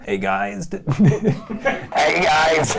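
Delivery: put-on creaky valley-girl drawl; valley girl vocal fry. Shown as a demonstration. Host demonstrating vocal fry for viewers, creaky valley girl. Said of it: "that sort of valley girl kind of vocal fry".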